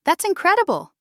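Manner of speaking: delighted, high-pitched amazement; positive shock. Shown as a demonstration. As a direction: exclaim it with tone leaping up. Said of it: "for positive shock".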